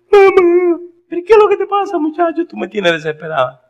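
high, loud and frantic; a mother begging her son to explain; desperate mother. Retold or imitated. (madre desesperada, aguda y fuerte)

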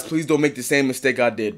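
ordinary talk, nothing marked

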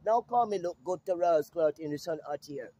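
ordinary talk

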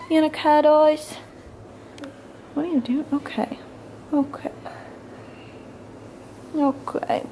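high, loving coo. Real, not acted. Cooing at toddler.

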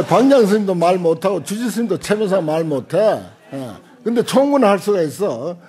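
ordinary talk

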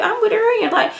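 imitating a defensive snap; incredulous retort. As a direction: throw it back defensively. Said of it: "I'm like".

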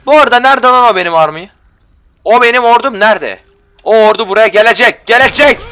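hyped up for his army; O ordu buraya gelecek, loud and eager; excited. Real, not acted. Excited about his army coming.

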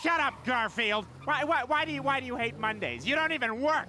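high, stammering outburst; manic scolding. Acted. Drugged driver berates an imaginary cartoon cat, manic. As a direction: stumble into a high-pitched manic rant.

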